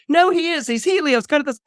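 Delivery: high-pitched frantic protest; indignant boy squeak; desperate insistence. Acted. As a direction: insist shrilly over the mockers. Boy insists, then gets cut off.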